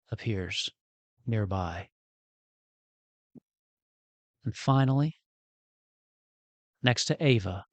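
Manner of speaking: slow narration broken by long pauses; measured game master storytelling; hushed scene setting. A narrator's reading. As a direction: reveal it piece by piece. Game master narrates last arrivals.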